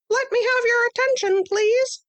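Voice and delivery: a tiny teacher calling the class to order; high little mouse-teacher voice; small Stuart voice. Acted.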